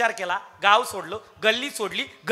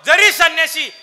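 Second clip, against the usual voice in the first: loud, high, punched list in short bursts; full-force preaching; 6 semitones higher, 10 dB louder than usual. Acted. Preacher listing what the sage left behind, full-force preaching. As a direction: hammer out each phrase, high and loud.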